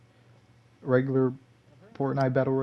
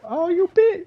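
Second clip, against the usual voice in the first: much higher, louder mock yelp; imitating teammate; 7 dB louder than usual. Gamer imitating his shocked teammate, high yelp. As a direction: squeal it like the startled teammate. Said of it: "and he's like".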